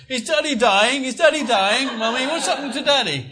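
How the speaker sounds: high, loud mimicked child's panic; little daughter. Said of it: "My little daughter was about eleven years old".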